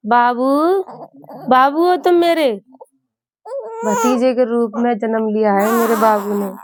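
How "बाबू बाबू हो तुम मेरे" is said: high, loud coaxing calls to a newborn. Real, not acted. Aunt calling to newborn nephew.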